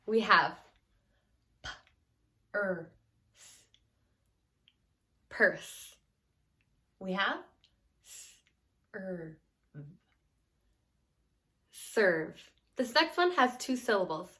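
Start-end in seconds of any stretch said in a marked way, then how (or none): 0.1-6.0 sounding out purse sound by sound, then blending. Shown as a demonstration. Teacher blends sounds into purse for students. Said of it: "read some words together". 7.0-12.4 breaking serve into separate sounds, then blending; teacher sounds out serve for young readers; sounding out. Shown as a demonstration. Teacher sounds out serve piece by piece for students. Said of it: "read some words together".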